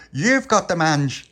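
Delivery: high, loud indignant protest; indignant denial. Acted. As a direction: shout it down, offended. Tony accuses back.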